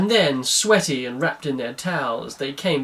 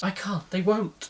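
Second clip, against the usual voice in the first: softer, higher tearful protest; acted crying; 5 semitones higher, 4 dB quieter than usual. A narrator's reading. His Excellency refusing Cindy's advice, softly tearful. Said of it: "He was crying again".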